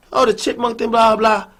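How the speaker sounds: ordinary talk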